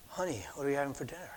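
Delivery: barely audible, quick and soft; said really soft. Retold or imitated. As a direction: murmur it fast, hardly above a breath. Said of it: "he said it really soft".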